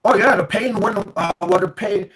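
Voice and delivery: normal talking voice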